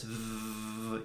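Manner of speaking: a long buzzing V, "V" with the vocal cords vibrating; voiced V sound. Shown as a demonstration. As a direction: hold a soft voiced V so the throat buzzes. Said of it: "if you do a V sound".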